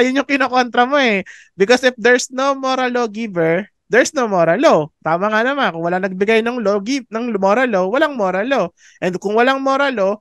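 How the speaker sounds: ordinary delivery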